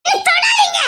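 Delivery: high, sharp don't touch me; shrill refusal. Acted. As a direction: push him off with your voice. Wife pushes husband away. (கணவனைத் தள்ளிவிடும் கோபக் குரல்)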